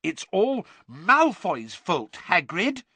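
high, loud, slow earnest insistence; emphatic and earnest, pitched well up. A narrator's reading. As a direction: insist slowly to reassure a friend. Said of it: "said Hermione earnestly".

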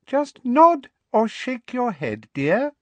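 high, slow, syrupy coaxing; coaxing Umbridge. Acted. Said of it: "Umbridge said coaxingly".

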